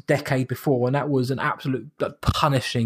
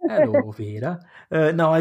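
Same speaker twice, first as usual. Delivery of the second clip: put-on northern accent. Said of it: "You'd do that odd northern accent".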